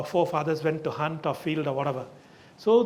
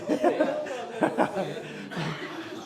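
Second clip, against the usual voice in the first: a second, softer round of real laughter; 3 semitones higher, 4 dB quieter than usual. Real, not acted. Still amused after apologising to the guest, a softer laugh. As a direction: keep laughing quietly.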